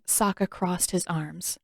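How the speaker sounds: plain reading voice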